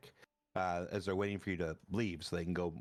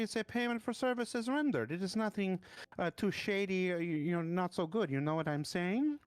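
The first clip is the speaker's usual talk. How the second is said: much higher, defensive shady merchant; very high, indignant little merchant insisting he did nothing wrong; nervous merchant voice; 11 semitones higher than usual. Acted. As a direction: protest your innocence in a high squeaky voice. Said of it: "he goes".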